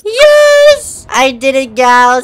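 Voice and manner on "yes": high, loud yelp of victory; triumphant cheer. Real, not acted. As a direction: shout it out like you just won. Celebrating a win in game.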